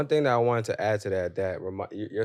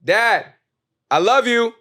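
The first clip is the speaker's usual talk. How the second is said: higher, louder second call to dad; 14 semitones higher, 8 dB louder, slower than usual. Retold or imitated. Calling louder to his unanswering dad, raised voice. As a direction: call out again, more insistent. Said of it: "So then I yelled it".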